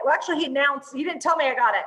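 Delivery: ordinary talk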